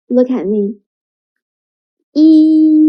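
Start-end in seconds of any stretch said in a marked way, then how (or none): 2.2-2.8 E as a long, loud, level ee; sustained e sound. Shown as a demonstration. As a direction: hold E steadily, tongue fixed. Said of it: "e first, and then let the tongue stays".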